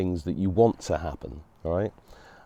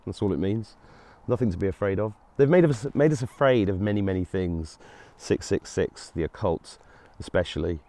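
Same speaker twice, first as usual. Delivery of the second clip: ordinary talk, nothing marked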